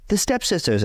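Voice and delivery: high-pitched mock thrill; excited imitation. Husband imitating wife spotting the stepsisters, high and excited. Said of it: "gets really excited and screams".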